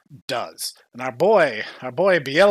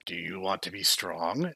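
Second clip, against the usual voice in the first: shady tempter; 8 semitones lower, 9 dB quieter than usual. Retold or imitated. Shady suspect making an offer.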